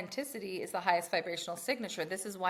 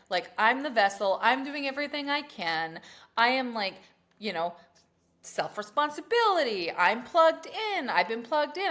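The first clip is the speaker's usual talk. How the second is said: raised, indignant complaining; mini tantrum; 5 semitones higher, 6 dB louder than usual. Retold or imitated. Woman demanding signs from the universe, raised indignant tantrum. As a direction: demand answers like a fed-up believer. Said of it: "a little mini tantrum".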